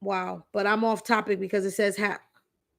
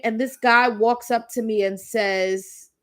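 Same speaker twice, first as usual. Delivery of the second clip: usual speaking voice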